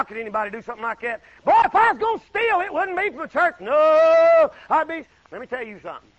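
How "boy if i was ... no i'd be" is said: loud and high, preaching full force; fired-up preacher crying out; rising preacher shout. Real, not acted. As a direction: build it up into a shout.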